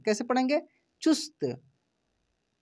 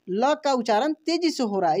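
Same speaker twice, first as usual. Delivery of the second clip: normal talking voice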